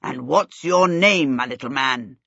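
genial king. A narrator's reading. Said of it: "the king asked genially".

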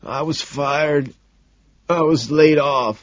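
slow, high, choked-up confession; choked sobbing. A narrator's reading. Homeless man admits losing his job, choking up. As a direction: force it out between sobs, drawn out. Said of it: "between sobs, chokes".

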